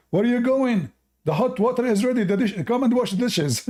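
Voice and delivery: high, loud scolding wife voice; bossy wife. Acted. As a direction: call him back to the dishes. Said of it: "The wife, she said".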